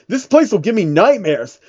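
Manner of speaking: plain reading voice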